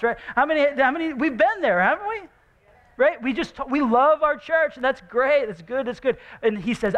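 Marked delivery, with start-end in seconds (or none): 0.4-5.5 rally the crowd's agreement; pitched-up eager enthusiasm; excited. Real, not acted. Pastor excited about loving church.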